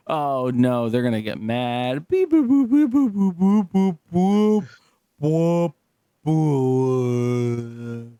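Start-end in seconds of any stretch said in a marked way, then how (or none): 2.1-8.1 beeps falling as the battery dies; sing-song beep boop, fading out; mock beeping. Imitating car dying.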